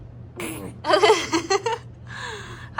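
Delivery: long, high rippling giggle; amused laugh. Real, not acted. Mother amused by her baby, high rippling laugh. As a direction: break into real, helpless giggles.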